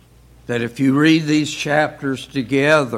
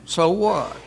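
"so what?" tossed off higher, with a shrug; dismissive so what; 5 semitones higher than usual. Retold or imitated. Teacher voicing an uncaring attitude, dismissive shrug. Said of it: "If a lot get killed or wounded".

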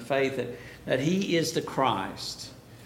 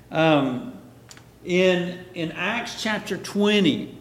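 ordinary talk

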